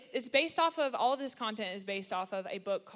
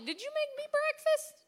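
much higher, put-on sweet voice asking for breakfast; mock needy wife voice. Wife teasing husband on podcast, in a high mock-sweet voice. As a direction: play a wife fishing for breakfast in a high cutesy voice. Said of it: "sometimes I'm like".

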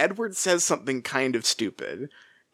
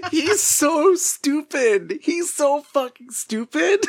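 laughing disbelief; slower than usual. Real, not acted. Laughing exasperated at Edward.

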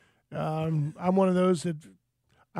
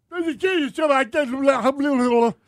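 high, loud garbled babble; speaking in tongues; 6 semitones higher, 6 dB louder than usual. Retold or imitated. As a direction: babble as if zapped into gibberish. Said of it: "you're speaking in tongues".

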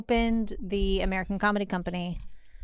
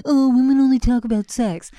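louder, slightly raised mocking voice; put-on complaining guy quote; mocking guys' complaint; 3 semitones higher, 6 dB louder than usual. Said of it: "They're like".

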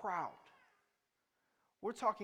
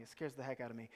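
ordinary talk

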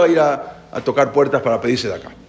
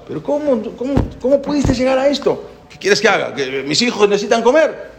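shocked friend; 9 semitones higher than usual. Retold or imitated.

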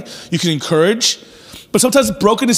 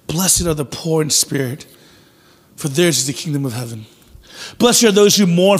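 scripture reading; 4 semitones lower than usual. Acted. Reads the Beatitudes.

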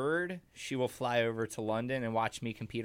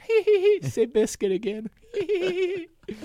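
giggle, very high and louder; high giggling; 6 dB louder than usual. Retold or imitated. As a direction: giggle like a delighted child. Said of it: "I'll just be in the corner giggling".

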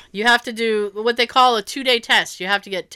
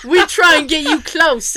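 louder imitation of a London cabbie; thick London accent; 5 semitones higher, 6 dB louder than usual. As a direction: put on a London accent, voice raised. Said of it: "like, that thick accent".

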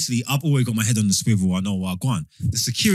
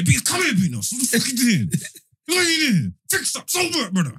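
mock scolding; 9 semitones higher than usual. Scolding drunk friend in retold scene.

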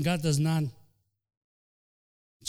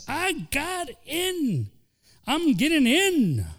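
high sly devil voice, drawn out; Satan gloating; 10 semitones higher, much slower than usual. Acted. Satan gloating over a complaining believer, sly high voice. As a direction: gloat like the devil who got in. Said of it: "he says".

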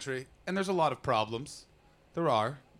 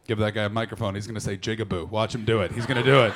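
lower, eager mock Brit voice; gleeful Brits; 8 semitones lower, 5 dB louder, faster than usual. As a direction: egg him on with glee. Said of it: "the British are still like".

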